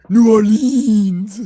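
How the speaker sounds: New Orleans drawn out slowly, loud, blended into two syllables; local pronunciation. Shown as a demonstration. Co-host shows the right way to say the city's name, slow and loud. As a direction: say it like a proud local, slow and full. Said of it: "upsets people when you mispronounce".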